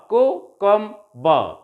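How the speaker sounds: cucumber drawn out slowly, each syllable clear; teacher slowing cucumber down for counting; slow syllable drill. Shown as a demonstration. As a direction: model cucumber slowly for the class to repeat. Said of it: "recite them after me".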